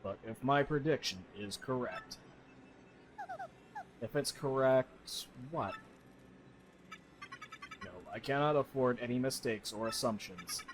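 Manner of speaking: a calm computer speaking low; computer TEC. Acted. Voices TEC computer in game.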